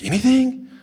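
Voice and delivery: high, rising, disbelieving "Anything?"; amazed question. Retold or imitated. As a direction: ask "Anything?" as if it can't be true. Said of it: "They're like".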